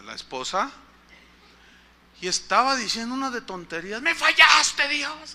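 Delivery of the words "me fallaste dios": mock despairing wife. Wife's cry after the birth.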